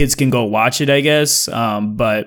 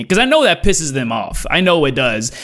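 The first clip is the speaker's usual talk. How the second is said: normal talking voice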